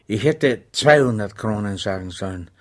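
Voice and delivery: muttering to himself, mildly annoyed; lightly irritated aside to himself; a touch of annoyance. A narrator's reading. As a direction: grumble it in self-reproach, understated. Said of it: "sagte er sich ärgerlich".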